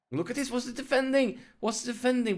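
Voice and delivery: high-pitched exasperated outcry; alarmed disbelief. Real, not acted. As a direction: pitch it up, appalled at the defending. Alarm at poor defending.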